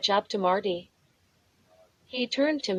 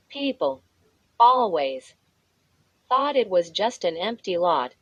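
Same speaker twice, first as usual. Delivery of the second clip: plain reading voice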